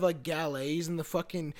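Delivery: ordinary delivery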